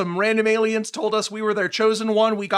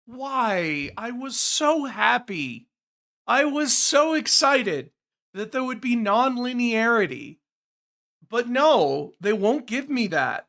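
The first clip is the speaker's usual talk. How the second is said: usual speaking voice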